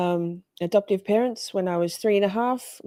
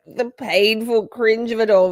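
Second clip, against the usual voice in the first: ordinary talk, nothing marked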